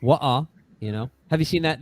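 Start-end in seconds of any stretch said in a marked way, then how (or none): 0.0-0.4 "Wa-uh" in Cockney with the T dropped; Cockney accent. Shown as a demonstration. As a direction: demonstrate Cockney "Wa-uh" with a glottal stop. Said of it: "A little bit more Cockney".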